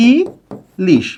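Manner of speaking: "Ilish" as ই, a pause, then লিশ; split into syllables. Shown as a demonstration. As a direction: space out the two syllables of "ilish". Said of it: "এর অক্ষর বিন্যাসটা হবে". ("ইলিশ" দুই অক্ষরে থেমে, ই... লিশ)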